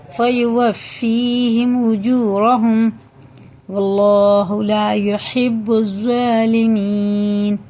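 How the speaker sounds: slow melodic chanted recitation; Quran recitation. Acted. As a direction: chant the verse slowly with long vowels.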